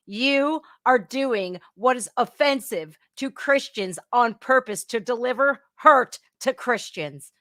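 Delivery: usual speaking voice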